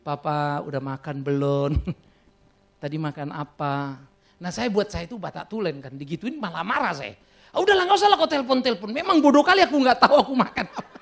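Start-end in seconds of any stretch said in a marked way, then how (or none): 0.1-4.0 gently imitating a wife asking if he ate; imitating tender wife. Pastor voices his tender wife. 7.5-11.0 irritated brush-off. Retold or imitated. Pastor voices his younger irritated self.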